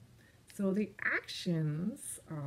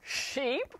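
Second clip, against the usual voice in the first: ordinary delivery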